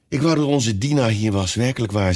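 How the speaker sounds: ordinary delivery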